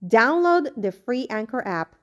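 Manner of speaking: an even, slightly subdued sponsor read; podcast sponsor spot. Acted. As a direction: read the ad copy smoothly. Host reads sponsor ad.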